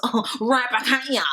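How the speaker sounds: Meg. Acted. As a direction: imitate a dismissive comeback in a silly voice. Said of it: "Meg was just".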